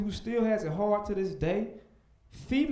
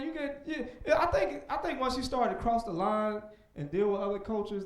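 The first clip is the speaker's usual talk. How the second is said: ordinary talk, nothing marked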